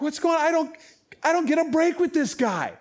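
high-pitched mock despair; exasperated centurion. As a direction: complain like an officer at the end of his rope. Voicing the exasperated Roman centurion.

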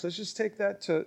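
ordinary talk, nothing marked

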